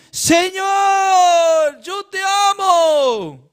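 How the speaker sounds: high, loud pious cry; hammed-up shouting of love to the Lord; mock fervent shout. Preacher imitates a believer shouting his love to God, hammed-up high cry. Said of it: "gritando como dice".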